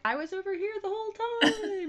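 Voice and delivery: high, mock-defensive voice, well above your own; sound guy protesting. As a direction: play the sound guy insisting he never moved. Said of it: "the sound guy's like".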